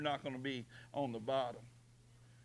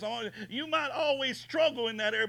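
ordinary talk, nothing marked

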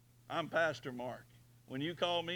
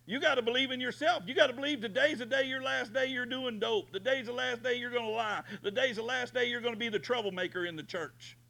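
high, fast pep talk; booming motivational speech; 8 semitones higher, 5 dB louder than usual. Acted. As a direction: fire them up, quick and high. Motivational preaching climax.

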